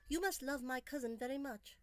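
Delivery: barely hidden bitterness; polite words with a bitter edge. A narrator's reading. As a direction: try to hide the bitterness, but let it seep through. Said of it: "trying to keep the bitterness from her tone, but it seeped into the words anyway".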